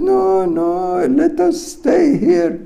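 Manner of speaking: high, loud panicked plea; acting out a scared servant begging to stay; frightened refusal. Disciple refuses to meet the armies, high-pitched panic. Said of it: "he was still frightened".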